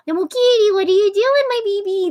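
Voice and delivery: put-on owner's sing-song baby voice for the cat; pet voice. Shown as a demonstration. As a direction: coo quickly to get the cat's attention. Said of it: "I change my voice when I'm talking to her".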